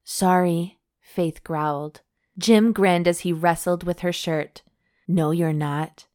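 steady read-aloud storytelling voice; romance story narration. A narrator's reading. Host reading a steamy novel to her cohost, narration voice. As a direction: read the love scene out like an audiobook.

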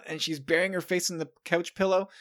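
ordinary talk, nothing marked

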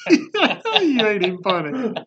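slow, high laughing words. Real, not acted. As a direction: say it through laughter. Laughing at the joke.

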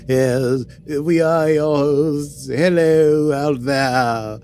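put-on very slow, inconsistent British accent; weird British accent. Host opens the Star Trek podcast, drawn-out wobbly British accent. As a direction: draw it out like a hammy British announcer. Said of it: "the weird British accent that's inconsistent".

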